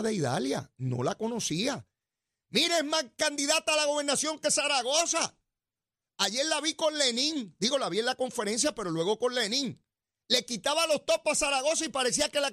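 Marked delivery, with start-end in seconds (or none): none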